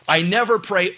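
ordinary talk, nothing marked

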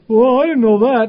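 high, loud exclaiming; mock incredulous. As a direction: push it way up high, mocking a lame excuse. Preacher mocks people's excuses.

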